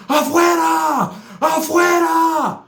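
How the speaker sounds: high, slow stretched shout; yelling afuera. Retold or imitated. Naked man shouting Afuera at the door. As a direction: bellow it out at the door. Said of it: "and he's yelling".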